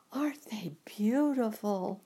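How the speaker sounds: whispered in awe; Dorothy whispering at the flowers; whispered wonder. Acted.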